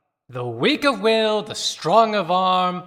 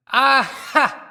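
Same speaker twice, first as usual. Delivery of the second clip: loud high exclamation, sudden; spring it on them like a trap snapping shut; triumphant aha; 6 semitones higher, 7 dB louder than usual. Acted. Reciter catching the listener in the trap, loud triumphant cry.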